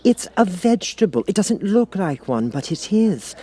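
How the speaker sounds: put-on high, unsure girl's voice; Alice. A narrator's reading. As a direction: guess hesitantly. Alice continuing.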